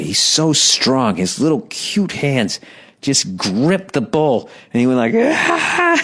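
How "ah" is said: high-pitched mock yell; imitated child's yell. Dad imitates his son gripping the bowl, mock high yell. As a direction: squeal like a furious toddler, without full volume. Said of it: "started yelling".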